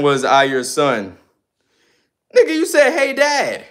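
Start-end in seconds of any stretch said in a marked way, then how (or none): 2.3-3.6 high mocking voice; squeaky mock of a chat comment; high, mock-childish quote; mocking viewer.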